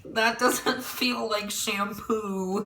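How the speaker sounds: high, very slow whine; mock whining protest. To friends pouring syrup on her, high slow whine. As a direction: drag out a high, dazed complaint. Stoned self protesting, acted.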